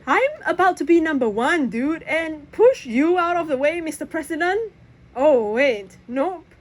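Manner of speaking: high, playful boast; cocky trash talk. Acted. To Press on the boat, high cocky trash talk. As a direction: brag back with a grin.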